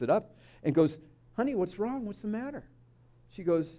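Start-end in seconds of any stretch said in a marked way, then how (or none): 1.4-2.6 higher, softer, gentle coaxing; concerned grandma. Acted. Grandma picks up her downcast grandson, tender and concerned. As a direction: ask tenderly like a worried grandma.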